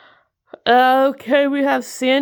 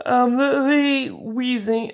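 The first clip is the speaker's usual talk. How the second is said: normal talking voice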